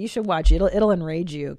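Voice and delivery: ordinary talk, nothing marked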